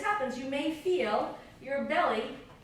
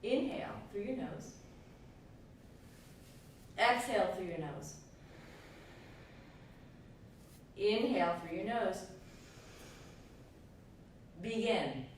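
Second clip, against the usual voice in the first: breath coaching cues. Acted. Leads breath of fire rounds.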